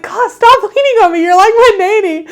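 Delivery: laughing imitation of a grumpy husband; quoting husband. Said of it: "he would be like".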